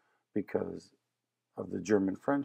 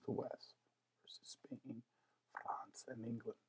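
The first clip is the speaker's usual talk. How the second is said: whisper; 2 semitones higher, 17 dB quieter than usual. Real, not acted. Teacher drops to whisper mid-list.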